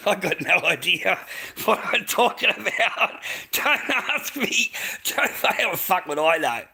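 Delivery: laughing it off, high and loud. Real, not acted. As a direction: laugh through the words, amused at yourself. Laughing at his own confusion.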